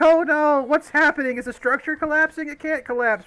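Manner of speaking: high, loud mock-frantic cries; hammed-up crowd freaking out, voice shooting up; panicked bystanders. Game master voices bar crowd freaking out, hammed-up high panic. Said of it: "everybody else is freaking out a little bit".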